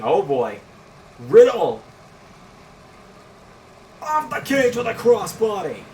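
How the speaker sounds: loud high play-by-play yell; excited shout. Real, not acted. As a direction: scream the big dive call. Calling a dive off the cage.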